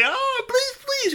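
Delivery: very high mock squeal; mocking rabbit's begging. Fox imitating rabbit begging, mocking squeal. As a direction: plead in an exaggerated high whine. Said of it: "squirm in the thorns and say".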